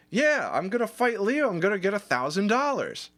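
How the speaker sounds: high, eager boast; cocky kid showing off; bragging. Retold or imitated. Telling his boss about the fight, high eager boast.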